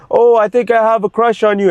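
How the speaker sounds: a hint of a teasing girl's voice; imitating a prankster girl, lightly. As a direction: lightly play the girl faking a crush. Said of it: "the girls would also play the pranks".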